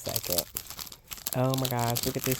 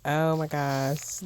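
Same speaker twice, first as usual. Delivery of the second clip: usual speaking voice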